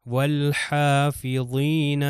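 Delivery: والحافظین slowly chanted, lam clearly sounded; Quran recitation. Shown as a demonstration. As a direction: stretch out والحافظین with a voiced lam. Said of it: "لام جو ہے پروناؤنس ہوگا".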